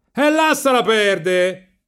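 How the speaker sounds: high, loud, stretched-out yell; shouting. A narrator's reading. Companions yell at Riccetto from the boat, high long shout. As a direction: holler it from a distance. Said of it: "gli gridarono i compagni dalla barca".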